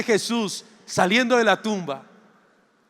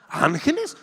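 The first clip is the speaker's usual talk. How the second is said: incredulous; 7 semitones higher than usual. Acted. Disbelieving disciples hear about angels.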